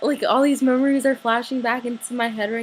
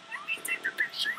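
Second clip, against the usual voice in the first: breathless whisper, fast; excited whisper; 8 dB quieter, faster than usual. Real, not acted. Telling viewers about the photo with Jake, a hushed whisper. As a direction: whisper it like a secret thrill.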